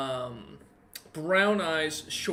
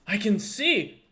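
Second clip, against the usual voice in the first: high, louder gasp of joy; joyful cry; 7 semitones higher, 5 dB louder, slower than usual. Acted. Shopkeeper regains sight, high excited cry. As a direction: burst out with wonder. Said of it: "and he goes".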